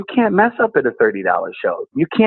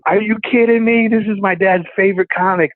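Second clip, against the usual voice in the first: higher-pitched, thrilled inner voice; excited disbelief; 7 semitones higher than usual. Retold or imitated. Comic retells realizing he'll meet dad's favorite, excited disbelief. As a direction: blurt it as an amazed thought.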